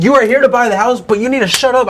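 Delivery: high, insistent mock scolding; retold arguing. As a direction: re-enact telling someone off, pitch well up. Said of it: "went back and forth arguing".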